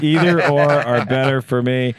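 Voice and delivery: high, loud, odd voice; reading Tom's text. Retold or imitated. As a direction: read it like his odd wording. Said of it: "I said".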